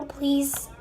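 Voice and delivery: normal talking voice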